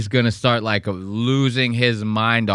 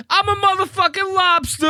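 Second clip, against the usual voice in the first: very high, squeaky mock tough-guy rap line; mock rapper boast; 3 dB louder than usual. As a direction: squeal it out like a wannabe rapper freestyling. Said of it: "doing it like a rapper".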